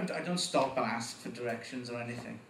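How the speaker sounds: ordinary talk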